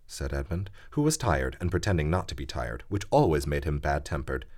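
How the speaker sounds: plain reading voice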